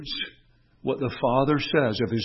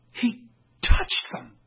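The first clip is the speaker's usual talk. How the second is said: whisper; slower than usual. Acted. Hushed moment in sermon.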